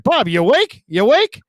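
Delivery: high, loud mock wake-up call; imitating Gary teasing a caller. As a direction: poke a sleepy caller awake, teasing. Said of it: "he'd be like".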